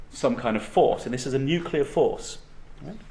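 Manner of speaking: usual speaking voice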